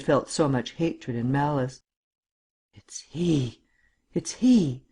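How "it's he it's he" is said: gasped, horrified whisper rising in pitch; breathy stage whisper to oneself, swelling; said to herself. A narrator's reading. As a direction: whisper it in dawning horror. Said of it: "she said to herself".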